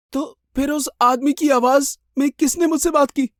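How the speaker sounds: high, alarmed question; frightened bewilderment. Acted. Victim realising the voice was no living man's, high and frightened. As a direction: ask haltingly in rising dread.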